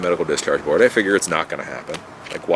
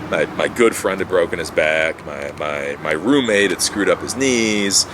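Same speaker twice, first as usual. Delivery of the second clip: ordinary talk, nothing marked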